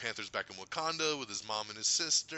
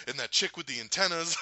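quoting Rocket; 7 semitones higher, 5 dB louder than usual. Retold or imitated. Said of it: "He goes".

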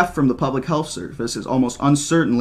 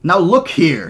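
stern; 6 semitones higher, 6 dB louder, slower than usual. A narrator's reading. Hammond pushing back in argument.

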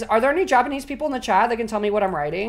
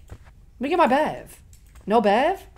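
ordinary talk, nothing marked

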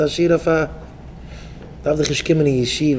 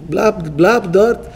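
mock German voice; much slower than usual.

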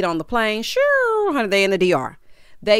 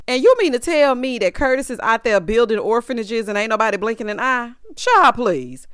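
ordinary delivery

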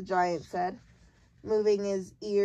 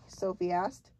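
plain reading voice

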